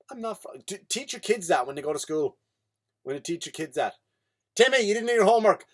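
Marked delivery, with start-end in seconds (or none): 4.6-5.6 loud, high, fast telling-off; mock teacher raising the voice; scolding teacher. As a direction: call out a pupil sharply. Teacher scolding pupil in acted classroom.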